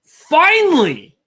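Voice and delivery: "finally" drawn out long, high and loud; emphatic "finally". Real, not acted. Co-host telling viewers Stephanie came round to James, emphatic drawn-out "finally". Exasperated emphatic repeat of a word.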